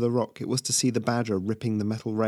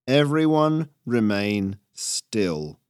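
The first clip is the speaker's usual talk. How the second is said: slow, drawn-out command; 3 dB louder, much slower than usual. Acted. Squirrel raider halting a crowd, slow command. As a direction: order them slowly and deliberately.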